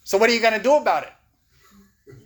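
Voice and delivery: loud, high-pitched challenge; Tomas impression. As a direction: imitate a tough sponsor confronting a self-pitying friend. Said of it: "That's how he talks".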